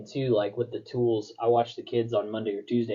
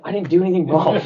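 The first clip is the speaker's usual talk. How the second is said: laughing; 8 semitones higher, 10 dB louder, faster than usual. Real, not acted. Laughing at son's forgiveness.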